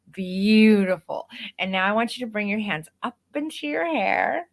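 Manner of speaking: bright, bouncy photographer coaching a client through poses; upbeat coaching voice. Shown as a demonstration. Photographer coaching a boudoir client, upbeat and encouraging. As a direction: pump them up, keep it playful and energetic. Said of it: "my vocal intonation went from the way that I'm talking to you".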